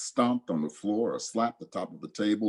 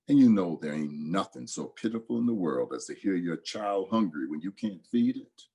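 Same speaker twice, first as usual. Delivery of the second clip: ordinary delivery, nothing marked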